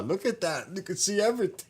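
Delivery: high, excited marveling; mock excited tourist. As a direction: gush over the view. Quoting people in the car.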